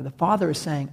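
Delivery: ordinary talk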